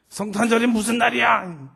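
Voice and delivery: 높고 크게 캐묻기; 당돌하게 되물으며 몰아붙이기; 따지는 딸. Retold or imitated. 딸이 아빠에게 따짐. (대들듯 묻는 말투)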